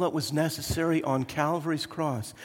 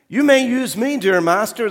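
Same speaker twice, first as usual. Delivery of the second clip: high, loud polite-but-picky voice; fussy wooden bowl; 9 semitones higher, 11 dB louder than usual. Acted. Wooden bowl bargains with the master, high and fussy. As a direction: offer yourself, with conditions. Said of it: "the wooden bowl said".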